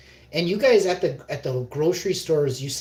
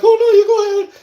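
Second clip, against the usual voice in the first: very high, loud mimicking voice; mock polite refusal; 11 dB louder, faster than usual. Imitating dinner companions waving off the crumb, high mock politeness. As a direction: squeal it like overly polite companions.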